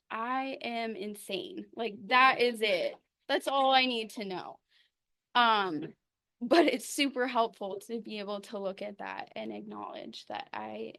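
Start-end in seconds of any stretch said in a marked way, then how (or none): 0.1-4.6 retold blunt self-verdict, growing much louder; emphatic, loud self-mocking conclusion; emphatic realisation. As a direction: land it hard, like a sudden revelation.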